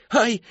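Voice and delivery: high loud yelp; sudden pained yelp; sharp cry of pain. A narrator's reading. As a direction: yelp as if burned. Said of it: "вскрикнула Мэри Кэтрин".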